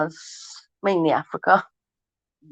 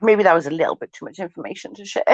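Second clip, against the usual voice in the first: ordinary talk, nothing marked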